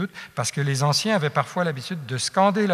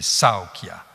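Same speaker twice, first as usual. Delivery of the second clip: « saokia » scandé lentement: SAO long, ki-a brefs; une longue, deux brèves; 5 semitones lower than usual. Shown as a demonstration. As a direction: allonger le début de « saokia », puis deux brèves. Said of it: "donc deux brèves". (« saokia » en dactyle, première syllabe longue)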